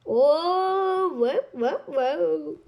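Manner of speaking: ordinary delivery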